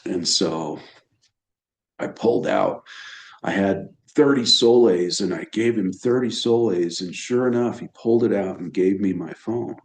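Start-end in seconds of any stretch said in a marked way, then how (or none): none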